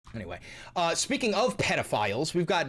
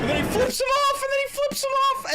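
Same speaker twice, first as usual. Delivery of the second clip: very high, loud squealed commentary; excited outburst; 6 dB louder than usual. Real, not acted. Host narrating the video's rude gesture, excitedly shouted. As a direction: crow it twice, high above the video.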